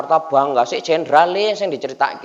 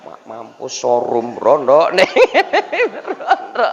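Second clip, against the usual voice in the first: laughing joke; slower than usual. Real, not acted. Joking punchline.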